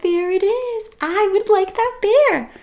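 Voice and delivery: put-on sweet high child wanting; little girl voice. A narrator's reading. Little girl asking mother for the bear, high. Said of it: "She said".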